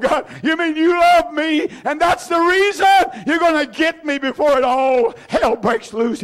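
half-squalled rapture, reliving it; a preacher retelling his joyful cry to God; overjoyed squall. Retold or imitated. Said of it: "I just squalled".

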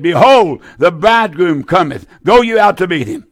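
normal talking voice

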